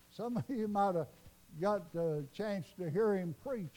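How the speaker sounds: ordinary delivery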